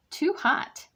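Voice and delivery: high, sharp complaint; complaining. A narrator's reading. Aluki burned by soup, high complaint. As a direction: squeal it out, too hot. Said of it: "she cried".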